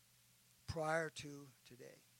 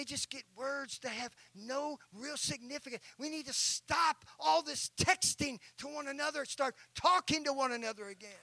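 louder, rising sermon exhortation; preaching at full force; 8 semitones higher, 8 dB louder than usual. Acted. Preacher urging his congregation to talk again, full-force preaching. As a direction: drive the point home to the church.

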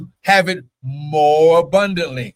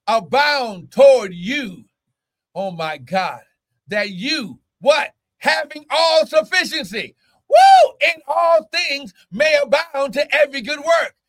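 preaching at full force; 6 semitones higher than usual. Acted. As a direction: drive it home like a fired-up preacher.

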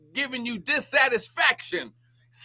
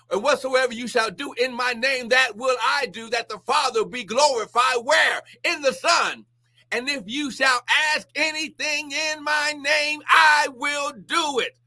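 rising preacher's cadence, higher and building to a shout; preaching scripture; 4 semitones higher than usual. Acted. Preacher quoting scripture to online viewers, building to a shout. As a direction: proclaim it like a revival preacher.

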